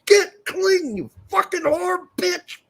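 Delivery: furious high-pitched yell at a pan; angry yelling. Retold or imitated. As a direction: scream abuse at the dirty pan. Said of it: "I was getting fucking pissed off".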